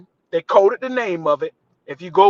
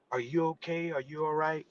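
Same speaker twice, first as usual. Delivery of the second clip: softer, gentle checking on him; soft concern; 11 dB quieter than usual. Retold or imitated. Director softly asking the fallen actor if he's okay. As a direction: ask quietly if he's hurt.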